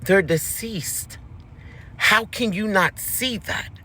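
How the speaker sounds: slow, hushed disbelief; fiance's slow reveal. Retold or imitated. Fiance tells her the kids are dead, slow and eerie. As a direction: lay it out slowly and eerily. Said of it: "He said".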